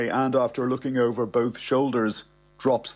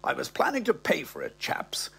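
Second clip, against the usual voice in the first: put-on whispered, sheepish old gentleman; 4 semitones higher, 4 dB quieter than usual. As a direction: murmur the excuse to the guards, almost voiceless. Old man to security guards.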